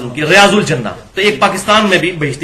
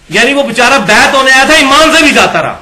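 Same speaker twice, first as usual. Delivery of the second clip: raised, louder lament; indignant outcry; 7 semitones higher, 6 dB louder than usual. Real, not acted. Lecturer protesting the disciple lost his faith, loud and high. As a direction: cry out at the injustice.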